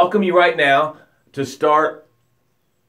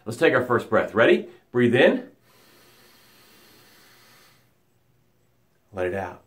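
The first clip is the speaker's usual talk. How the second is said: guided breathing; 5 semitones lower, 3 dB quieter than usual. Acted. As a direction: guide the breaths into calm stillness. Leads breathing exercise.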